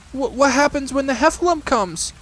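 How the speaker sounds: high, stammering, frightened piglet; little pig scared of the Heffalump; trembling. A narrator's reading. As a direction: stammer it out in fear. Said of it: "asked Piglet tremblingly".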